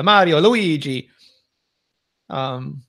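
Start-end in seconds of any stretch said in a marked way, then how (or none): 0.0-1.1 Mario, Luigi in a lifted Italian accent. Shown as a demonstration. Showing the Italian version to co-hosts, Italian accent. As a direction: roll it out like an Italian. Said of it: "I guess Italian would be".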